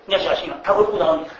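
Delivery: ordinary talk, nothing marked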